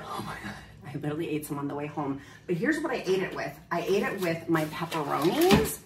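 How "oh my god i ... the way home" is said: quick guilty whisper; whispered confession. Real, not acted. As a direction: whisper it fast like a secret. Confessing snack eaten on way.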